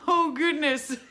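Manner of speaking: high, loud burst of laughter through the words; real laughing. Real, not acted. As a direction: laugh helplessly at your own stumble. Laughs at own mangled pronunciation.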